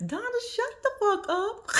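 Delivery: high, slow drawn-out scold; telling Donna off. Retold or imitated. Vlogger scolding Donna again, high drawn-out. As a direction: stretch it out high at her. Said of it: "repeated line to Donna".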